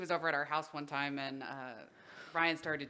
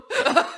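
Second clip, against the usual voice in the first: loud high delighted laugh; burst of laughter; 12 semitones higher, 11 dB louder than usual. Real, not acted. Telling her friend about the show, a loud laugh.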